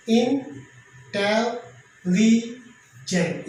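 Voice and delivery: इंटेलिजेंट drawn out very slowly, in-te-li-gent, each syllable apart; split into syllables. Shown as a demonstration. Teacher counting syllables for students: इंटेलिजेंट very slowly, syllable by syllable. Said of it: "इसमें four syllable है".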